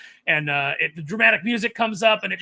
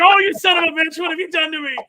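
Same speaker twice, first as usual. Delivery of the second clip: mock outrage; 12 semitones higher, 9 dB louder than usual. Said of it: "I was like".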